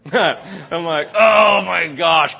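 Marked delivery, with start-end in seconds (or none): 1.1-2.3 loud, stretched-out groaning exclamation; hammed-up exasperated outburst; mock dismay. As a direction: draw it out in exaggerated disbelief. Acted dismay at wife's text.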